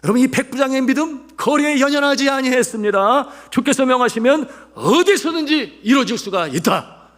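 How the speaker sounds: very high, loud pulpit cry; full-force preaching. Real, not acted. Preacher proclaiming the centurion's faith, full-force preaching.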